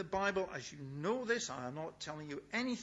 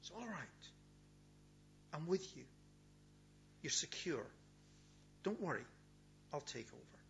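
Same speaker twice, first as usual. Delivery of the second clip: soft, hushed comfort, almost a whisper at the start; gentle reassurance; 9 dB quieter than usual. Pretend. God hugging frightened disciples, softly reassuring. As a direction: soothe a frightened friend quietly.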